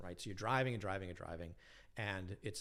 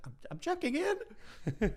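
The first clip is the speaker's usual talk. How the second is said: small high voice, slowly; timid squeak; 5 dB louder, slower than usual. Retold or imitated. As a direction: shrink and squeak it out. Meek reply to gruff clerk.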